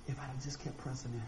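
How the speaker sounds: "if I'd have just kept pressing in" dropped soft and low in pitch, a hushed sigh of regret; quiet regret. Retold or imitated. Preacher imagining his regret after death, softly and sadly. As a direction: murmur it like a man looking back too late.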